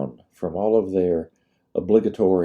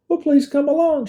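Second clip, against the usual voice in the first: high, bright southern lady voice; high lady's invitation; 4 dB louder than usual. A narrator's reading. Nurse inviting the lieutenant to meet the general, high. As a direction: invite him along, sweet and high.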